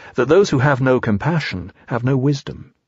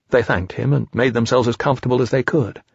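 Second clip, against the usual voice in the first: plain reading voice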